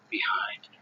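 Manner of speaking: whisper. Real, not acted. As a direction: breathe the word out in a whisper. Whispered end of a sermon point.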